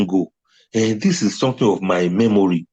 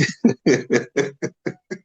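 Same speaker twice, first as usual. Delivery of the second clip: long helpless giggling fit; real laughter; 3 dB quieter than usual. Real, not acted. Wishing to pause and laugh very well, giggling helplessly. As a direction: laugh on until it tails away. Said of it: "so that I can laugh very well".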